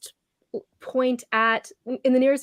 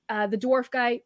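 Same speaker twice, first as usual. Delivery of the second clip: normal talking voice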